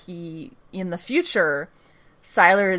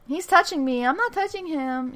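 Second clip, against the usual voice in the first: higher, whiny mock sibling tattling; squabbling siblings; 6 semitones higher, 5 dB quieter than usual. Host imitating siblings in the back seat, whiny mock tattle. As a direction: play both bickering kids, pitched up. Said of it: "They are those fucking siblings".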